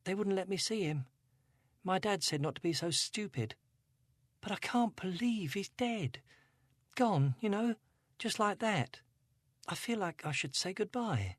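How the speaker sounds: Angela, a hint of upset teen; teenage girl. A narrator's reading. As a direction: voice her as a troubled teenage girl. Angela voiced.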